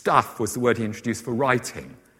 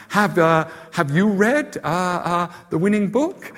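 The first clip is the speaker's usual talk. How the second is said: TV presenter quote; 7 semitones higher than usual. Retold or imitated. Presenter asking a lady at a table, mock interviewer voice. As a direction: put on a presenter's lifted, halting question.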